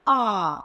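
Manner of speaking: आ held long and open; long drawn aa. Shown as a demonstration. Teacher to children, drawing out आ slowly. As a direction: stretch the vowel आ out. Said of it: "वो लंबी खींची जाएगी".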